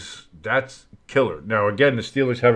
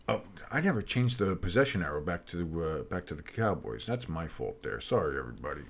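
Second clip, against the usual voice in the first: mumbled aside; 5 semitones lower, 9 dB quieter than usual. Real, not acted.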